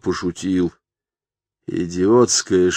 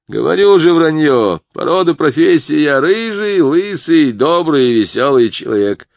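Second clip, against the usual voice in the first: louder, higher, heated denial; loud insistent protest; 8 semitones higher, 7 dB louder than usual. Acted. Morк loudly waving off the fortune, heated protest. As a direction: push back hard, nearly shouting.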